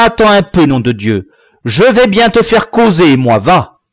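loud, high-pitched, ranting father; shouted scolding at full force; furious tirade. A narrator's reading. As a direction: rage at a good-for-nothing son. Father's furious tirade before beating.

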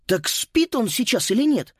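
high, puzzled question. Acted. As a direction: ask in bewilderment. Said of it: "озадаченно спросил Макс".

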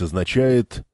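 ordinary delivery

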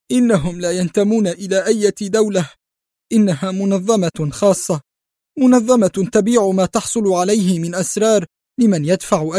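high, strained voice of a man falling apart; breaking down. Acted. Captured spy confessing to the director, breaking down. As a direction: plead as if everything is collapsing. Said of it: "قال في انهيار".

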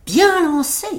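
high-pitched little tailor; tailleur assuré. A narrator's reading. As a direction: concede the throw in a high, cocky voice. Tailleur répond au géant. (petit tailleur, voix haut perchée)

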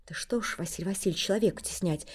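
quiet, mild appeal; soft plea. Acted. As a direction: plead softly for the man. Semyon gently intercedes.

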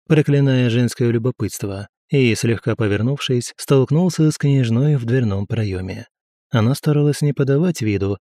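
ordinary delivery, nothing marked

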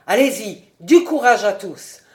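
loud rallying cry; shout. A narrator's reading. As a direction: healer shouting the helpers into action.

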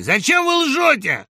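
high, loud, drawn-out heckle; accusing shout. A narrator's reading. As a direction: yell the accusation slowly across a crowd. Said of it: "крикнул Бобров из-под сосны". (обвиняющий крик)

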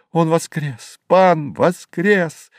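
high, drawn-out glad cries; lilting, elated high exclamations; joyful clamour. A narrator's reading. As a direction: echo the news like young voices calling. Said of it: "шумели молодые голоса".

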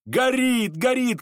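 high, alarmed cries; crowd crying out. A narrator's reading. Everyone cries in one voice at the fire, alarmed cries. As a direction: call the alarm twice, pitched high. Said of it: "кричали все в один голос".

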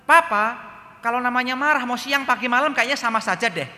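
put-on high, loud child's comeback; cheeky son's retort. Son answering his scolding father, high and loud. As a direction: fire back like a clever little boy.

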